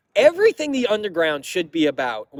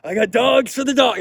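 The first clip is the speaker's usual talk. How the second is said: higher, louder eager fan calling out; mock excited fan, pitched up and loud; 6 semitones higher, 5 dB louder than usual. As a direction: play the overexcited guy with hot dogs. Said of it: "He's like".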